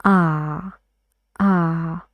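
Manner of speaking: ah with the back of the tongue up; careful teaching demo; demo of ah for heart. Shown as a demonstration. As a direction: open ah as in heart. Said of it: "when you say heart?".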